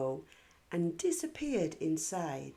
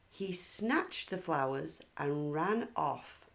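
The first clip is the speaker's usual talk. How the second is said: ordinary delivery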